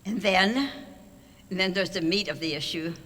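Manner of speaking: usual speaking voice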